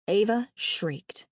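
ordinary delivery

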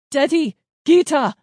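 loud, high calling out; yell. A narrator's reading. Dad calling his children through gunfire, loud yell. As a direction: shout the names over noise. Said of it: "Dad yelled through the noise".

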